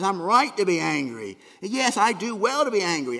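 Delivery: loud, indignant insistence on being right; mock angry Jonah defending himself; Jonah's angry retort. Pastor voicing Jonah's angry self-justification, loud and indignant. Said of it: "Jonah says".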